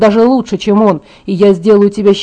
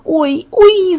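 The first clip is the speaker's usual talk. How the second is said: high-pitched acted yelp; sharply raised, pained "ow"; cry of pain; 9 semitones higher than usual. A narrator's reading. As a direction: cry out as pain shoots through your hand. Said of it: "закричала я".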